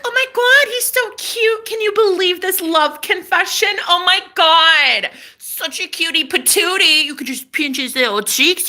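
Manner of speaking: high-pitched mock fangirl squeal; gushing friends. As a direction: imitate friends cooing over a crush, way up high. Imitating friends gushing over confession.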